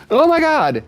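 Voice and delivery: shrieking cat spots a squirrel; startled cat. Acted. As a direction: voice the cat losing its mind at the squirrel. Cat voiced reacting to squirrel.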